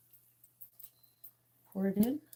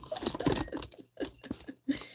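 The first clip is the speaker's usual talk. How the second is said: soft whispered giggle; fading amused laugh; breathy laugh; 6 semitones higher, 5 dB quieter than usual. Real, not acted. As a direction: laugh quietly. Laughing at own impression.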